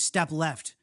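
ordinary delivery, nothing marked

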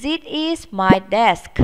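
slow, careful drill; slow classroom model; slow model sentence. Shown as a demonstration. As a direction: say it slowly for pupils to copy. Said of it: "Chúng ta sẽ nói".

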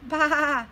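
playful sheep bleat. Acted. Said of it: "said Sheep".

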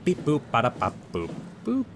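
soft beeping robot voice. Pretend. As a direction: talk like a toy robot. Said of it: "I'm a robot".

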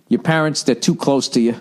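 ordinary delivery

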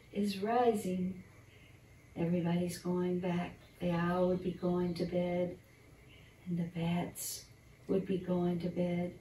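ordinary delivery

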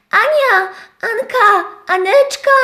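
wyżej, wołanie; wołaj wysoko Anię; krzyk. Acted. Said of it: "ja też krzyczałam". (owieczka woła Anię, dużo wyżej)

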